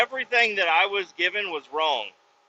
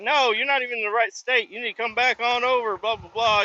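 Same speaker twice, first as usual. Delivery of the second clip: higher-pitched imitation of someone correcting you; higher voice, tailing into blah-blah; mock correcting caller; 8 semitones higher than usual. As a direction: imitate an exasperated caller in a raised voice. Retold caller correcting him.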